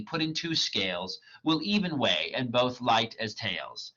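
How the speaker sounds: dramatic reading of verse; reading Shakespeare aloud. Acted. Reader voicing Hermia rejecting Demetrius, dramatic. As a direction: read the lines as the character, measured. Lysander and Helena read.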